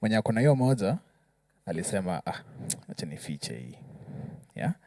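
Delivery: usual speaking voice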